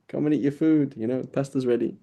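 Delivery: half-shouted mock call to the kids; mock motherly call. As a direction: lightly call the kids in to eat, pitch lifted. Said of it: "the Italian mothers will shout".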